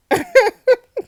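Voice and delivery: shrieking laugh; high burst of laughter. Real, not acted. Gamer laughing at the suspect's answer. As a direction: laugh out loud.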